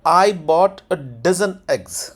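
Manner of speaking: very slow, "I bought" firm, falling to "eggs"; sentence tone demo. Shown as a demonstration. Teacher saying it with the tone, slow demo. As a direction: stress "I", step down through "dozen eggs". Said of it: "tone के साथ".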